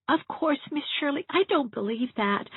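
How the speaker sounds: put-on high, small child's voice, wistful; a lonely little girl confiding; little girl's voice. Elizabeth telling Miss Shirley her father can't bear her, small and wistful. Child half-brave about crow.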